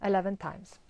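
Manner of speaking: ordinary talk, nothing marked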